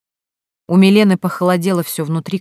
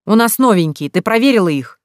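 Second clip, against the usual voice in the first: raised, louder accusing question; angry shout; 4 semitones higher, 5 dB louder than usual. A narrator's reading. Husband grilling wife about new captives, shouting. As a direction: demand an answer, pitched up and loud. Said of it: "закричал Сергей".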